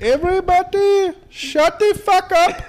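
high, slow mock Indian accent. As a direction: draw it out loudly in a put-on Indian accent. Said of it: "in an Indian accent".

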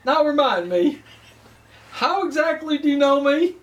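a hammed-up Southern accent flirting; southern gentleman. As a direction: lay the Southern charm on thick. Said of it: "I'll kick into my, uh, southern".

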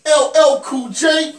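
high, loud, drawn-out cheer; excited shout. Real, not acted. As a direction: hype the name up. Echoes name, high loud slow.

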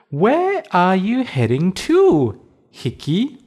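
high, slow bunny question. Acted. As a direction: ask kindly like a bunny. Bunny asks cricket.

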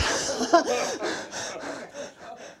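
high airy laugh of disbelief; breathy laugh. Real, not acted. Mother recalling God's answer, airy laughing. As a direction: laugh at the absurdity.

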